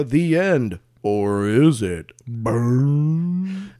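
slow, drawn-out teaser; mock horror ending. Host mocks writers who can't end stories, hammy and ominous. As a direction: stretch it into a hammy cliffhanger.

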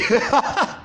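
a sudden high laugh breaking out; high burst of laughter. Real, not acted. Laughing at own impression. (risa aguda que se escapa)

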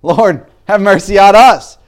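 high, loud pleading call; crying out for mercy. Retold or imitated. Blind men calling out to Jesus, loud high plea. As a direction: beg loudly for mercy, voice lifted. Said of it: "these two blind men cry out".

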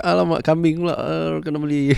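high put-on greedy voice; excited inner voice. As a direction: squeal over the mutton. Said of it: "And then macam".

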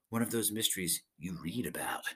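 hushed whisper to himself. Acted. Captain murmuring about the mystery, whispered. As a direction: breathe it out, half to yourself.

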